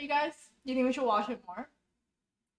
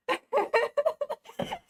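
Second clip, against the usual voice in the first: high giggle; 4 dB louder than usual. Real, not acted. Laughing before trying accent.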